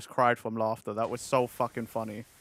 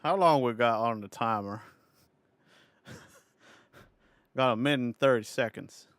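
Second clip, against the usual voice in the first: put-on Southern American accent. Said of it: "this really shitty Southern American accent".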